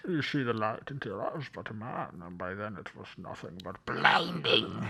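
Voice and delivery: muffled, breathy villain growl; Bane voice. Retold or imitated. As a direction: do a bad Bane impression. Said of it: "stick to the Bill Clinton program".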